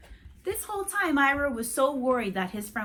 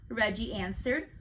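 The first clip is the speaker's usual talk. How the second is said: plain reading voice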